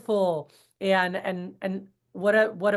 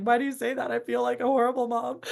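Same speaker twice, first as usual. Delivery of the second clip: teary disbelief; 4 semitones higher than usual. Retold or imitated. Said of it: "I was in tears".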